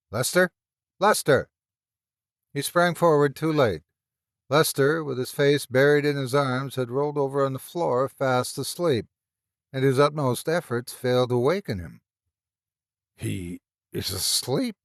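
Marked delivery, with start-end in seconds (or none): 0.1-1.5 rising call, then a louder cry; alarmed calling. Acted. Calling a friend who collapses. 13.1-14.7 slow, stammering disbelief; halting, barely getting the words out; stammered in fear. A narrator's reading. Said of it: "he stammered".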